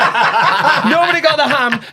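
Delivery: "Nobody got the ham" repeated slowly through laughter; laughing repeat. Real, not acted. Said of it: "nobody got the ham this year".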